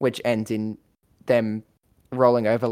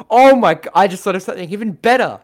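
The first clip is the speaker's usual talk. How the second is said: high, loud burst of sudden excitement; excited brainwave; 5 semitones higher, 6 dB louder than usual. Real, not acted. Podcaster pitching a cat James Bond to co-hosts, excited. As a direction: blurt it out, thrilled by your own idea. Struck by a better idea.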